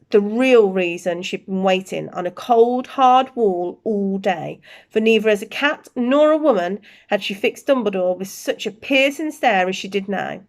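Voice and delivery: usual speaking voice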